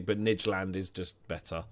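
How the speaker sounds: ordinary talk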